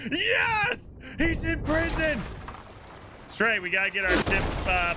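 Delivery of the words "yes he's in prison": excited cheer. Real, not acted. Cop cheering to the crew that the captain is jailed, excited cry. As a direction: cheer it like you just won.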